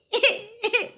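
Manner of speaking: a short high giggle; high laugh. Real, not acted. Laughs about baby.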